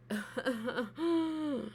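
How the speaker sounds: chuckles trailing into a long high wheeze; short giggles, then a drawn-out wheeze; laugh. Real, not acted. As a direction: laugh till it thins to a wheeze. Laughs at his retold complaint.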